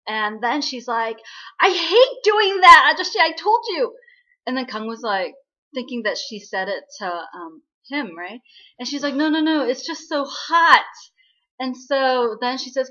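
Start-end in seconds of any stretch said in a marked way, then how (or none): 1.6-4.0 loud, high acted outburst of annoyance; exasperated outburst. Fed up with the ghost bothering her on a date, exasperated outburst. 9.1-10.9 loud, raised-pitch acted protest; hurriedly insist, high and loud; flustered denial. Covering up an outburst in front of her date, flustered denial.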